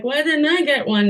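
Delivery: high-pitched mock question to a friend; asking friend, retold. Asking her friend why no baby shower, in a high mock-puzzled voice. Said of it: "And I asked later".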